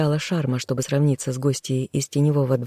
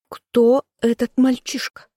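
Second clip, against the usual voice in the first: high, sharp demand of an outraged woman; indignant sister; 8 semitones higher, 3 dB louder than usual. A narrator's reading. Indignant sister demanding answers from her brother the king. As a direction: spit out the question in outrage. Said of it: "возмущённая женщина".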